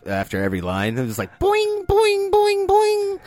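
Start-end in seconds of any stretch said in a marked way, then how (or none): 1.4-3.2 high, springy boings; cartoon boing effect. Imagining a sound effect after every line, high cartoon boings. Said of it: "like, a sound effect".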